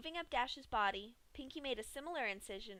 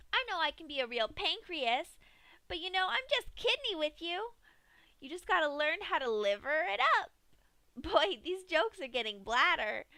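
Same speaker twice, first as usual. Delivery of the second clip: high, louder punny patter; joking Pinky; 6 semitones higher, 7 dB louder than usual. A narrator's reading. Pinky tells organ puns to the dying Dash, gleeful. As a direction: deliver bad puns like a comedian. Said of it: "Pinky began to make jokes".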